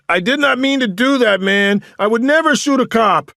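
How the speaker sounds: high, loud shouted protest; yelling. A narrator's reading. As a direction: yell your innocence desperately.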